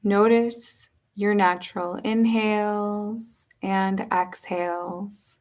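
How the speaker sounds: slow, unhurried yoga nidra guiding voice with long pauses; measured, spacious meditation delivery; guided meditation. Acted. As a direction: guide them gently into rest, leaving space between phrases.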